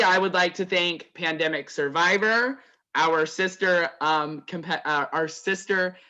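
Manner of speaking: ordinary talk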